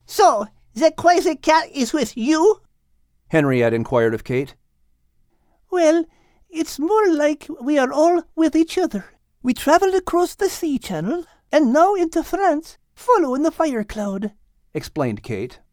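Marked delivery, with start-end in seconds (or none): 0.0-2.6 higher, nosy French hen; a lightly voiced busybody hen; bossy hen. Henriette questioning Kate about the cat. 5.7-14.3 higher, kindly fussing voice; a light motherly cat clucking over a mess; Kate, gentle. Acted. Kate asking the others to wake Al gently. Kate's voice.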